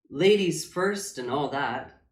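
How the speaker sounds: plain reading voice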